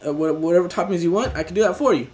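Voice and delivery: ordinary talk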